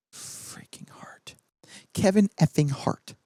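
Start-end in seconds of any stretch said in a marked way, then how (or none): 0.1-1.3 a whispered swear word slipped in; whispered curse. Real, not acted. Naming the actor Kevin Hart to viewers, whispered swear. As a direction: hiss the swear word under your breath. Aside while naming the cast.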